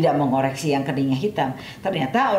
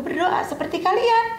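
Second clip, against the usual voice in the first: much higher, plain-spoken reply in character; high voiced gardener answering. Gardener's quote. (suara tinggi tukang kebun menjawab)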